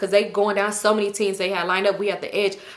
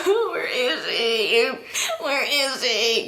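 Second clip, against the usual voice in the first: slow, drawn-out mock sobbing; crying; 2 semitones higher, much slower than usual. Girl looking around for her lost cousin, acted sobbing wail. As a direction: wail each word out in pretend tears. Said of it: "I'm crying".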